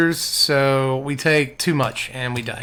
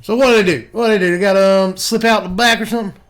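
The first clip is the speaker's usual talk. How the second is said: country voice; 3 semitones higher than usual. Acted. Country voice held in commentary.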